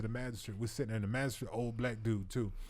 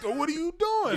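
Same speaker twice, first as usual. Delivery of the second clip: high pained protest; pained yell; 14 semitones higher, 5 dB louder than usual. Retold or imitated. Cuffed man protesting the leg bar, pained yell. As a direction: cry out against the pain. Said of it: "I'm in pain".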